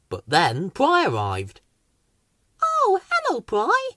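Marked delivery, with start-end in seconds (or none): 2.6-3.9 Weed's voice. A narrator's reading. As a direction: chirp it high and gentle. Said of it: "said Weed".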